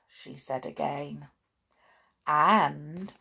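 ordinary delivery, nothing marked

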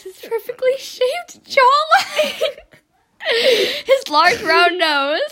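slow, high mock swoon; laughing while reading. Real, not acted. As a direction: gush over him through giggles. Mock-swooning description of Shrek.

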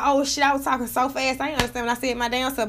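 fast, tumbling words running together; rushed self-quote. Retold or imitated. Talking about her own speech, a fast tumbling self-quote. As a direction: rattle it off too fast to follow. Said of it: "I be like".

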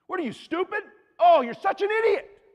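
high, loud mock outburst; angry insult. Someone berating another person as an idiot, angry. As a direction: explode in exaggerated exasperation.

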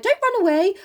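high, acted plea; pleading suitor. Mister Brooks to Meg as she runs, acted pleading. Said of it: "Mister Brooks is like".

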